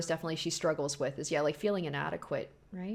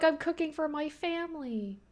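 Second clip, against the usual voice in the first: higher, sweet imitation of the wife; lightly voiced happy wife, pitched up; a hint of a proud homemaker, higher; 9 semitones higher than usual. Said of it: "she's like".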